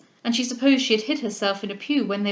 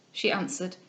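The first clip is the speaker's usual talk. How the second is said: plain reading voice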